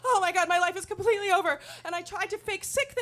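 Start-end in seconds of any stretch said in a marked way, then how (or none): 0.0-1.6 fast, higher, crying panic; panicked wail. Retold or imitated. Teen imagining school finding out, fast crying panic. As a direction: spiral into teary panic. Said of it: "the second wave of panic set in".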